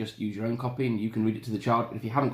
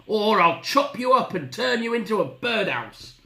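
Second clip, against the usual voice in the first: high, forceful woodcutter threat; bellowed threat; 9 semitones higher, 4 dB louder than usual. Acted. Woodcutter threatening the wolf, forceful raised bellow. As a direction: keep bellowing the warning. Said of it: "bellowed the wolf cutter".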